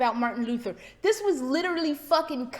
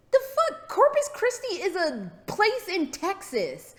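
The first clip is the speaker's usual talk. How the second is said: high-pitched incredulous retort; exaggerated, higher-pitched bewilderment; mock disbelief; 6 semitones higher than usual. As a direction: retell it in baffled disbelief. Acts out her bewildered reaction.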